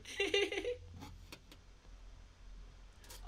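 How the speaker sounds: long, high giggling fit; helpless high tittering; amused giggle. Real, not acted. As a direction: dissolve into high giggles at your own joke. Laughs at own joke.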